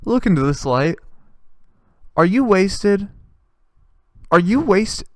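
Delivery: high, loud, hammed-up official; mock police officer. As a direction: announce it like an over-keen cop. Acted officer announcing the punch card.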